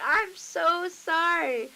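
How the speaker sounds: loud, high, drawn-out retold apology; imitated mortified apology. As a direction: wail the apology in mock mortification.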